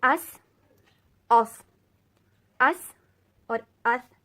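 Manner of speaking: اس thin and crisp, اوف heavy and thick; sibilant drill. Shown as a demonstration. Teacher drilling sibilants for students, loud. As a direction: repeat each in turn. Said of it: "اتنا فرق ہے".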